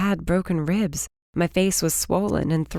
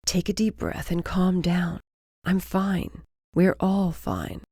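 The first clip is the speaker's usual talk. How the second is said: reassuring. Acted. Calming panicked woman.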